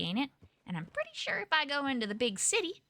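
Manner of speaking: ordinary talk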